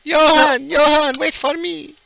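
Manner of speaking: very high, loud character call; hammed-up high-pitched wail, fading at the end; calling after beloved. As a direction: cry out after someone getting away. Character calls after Johann while running.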